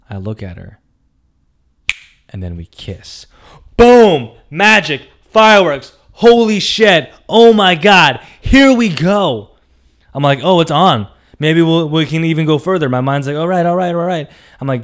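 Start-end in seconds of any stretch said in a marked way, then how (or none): 3.8-9.5 loud, high bursts of exhilaration; triumphant, hyped-up exclamations; ecstatic shouting. Retold or imitated. Acting out the thrill of kiss. 10.4-14.3 raised, eager inner voice; hyped retold thoughts; excited anticipation. His excited thoughts after the kiss.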